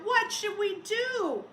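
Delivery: put-on high, loud, slow worried children's call; children crying out. A narrator's reading. Teacher voicing the children's worried cry. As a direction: call out like anxious kids. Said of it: "The children cried out".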